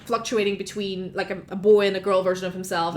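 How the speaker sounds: ordinary talk, nothing marked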